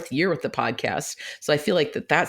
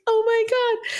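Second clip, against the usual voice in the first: high-pitched mock-gushing reaction; high, giddy retold delight; delighted disbelief; slower than usual. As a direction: retell a thrilled gasp of surprise. Retells delight at new friendship.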